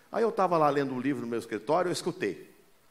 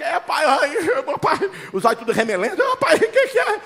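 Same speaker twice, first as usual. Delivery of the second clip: filho sonolento; 9 semitones higher, 5 dB louder than usual. Retold or imitated. Filhos acordando assustados.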